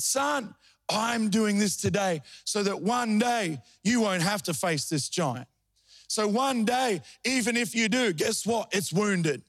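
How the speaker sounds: high-pitched, surging Pentecostal preaching. Acted. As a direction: preach it up and ride the cadence. Preaching at full force.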